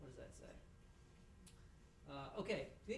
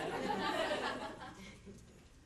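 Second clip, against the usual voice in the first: laugh; 7 semitones higher, 4 dB louder than usual. Real, not acted. Laughs about tissues.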